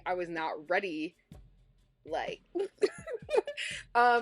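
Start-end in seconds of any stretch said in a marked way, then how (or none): 2.4-3.9 nervous laugh. Real, not acted. Laughs off talk of dying.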